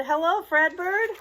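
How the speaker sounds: high, loud, sing-song hello; cheerful greeting. Acted. Fans admiring the statue greet Fredbird, loud cheerful call. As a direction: wave and call it out brightly. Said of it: "they waved and they said".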